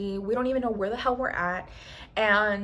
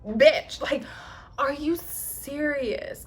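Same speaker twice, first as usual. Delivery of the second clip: exasperated; 5 semitones higher, much slower than usual. Retold or imitated. Drink spilled over her cereal.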